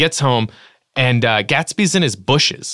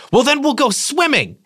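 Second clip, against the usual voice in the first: eager Gatsby; 13 semitones higher than usual. Retold or imitated. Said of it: "he goes".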